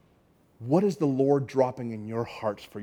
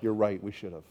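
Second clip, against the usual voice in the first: discouraged inner voice; 5 semitones lower, 5 dB quieter than usual. Retold or imitated. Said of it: "inside I'm just like".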